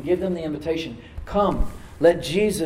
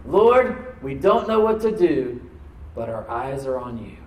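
crying out to God; 6 dB louder, slower than usual. Retold or imitated. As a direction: cry out the prayer to heaven. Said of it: "they cried out to God".

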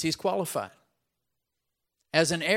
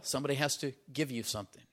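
ordinary talk, nothing marked

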